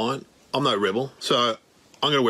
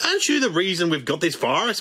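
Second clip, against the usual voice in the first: ordinary talk, nothing marked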